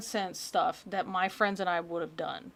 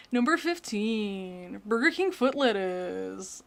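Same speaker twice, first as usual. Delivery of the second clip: slow, drawn-out mock countdown voice; mimicking a list narrator; slower than usual. Mocking the guy whose voice she hates, slow narrator drawl. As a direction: stretch every item like that hated narrator. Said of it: "I wanna punch his voice".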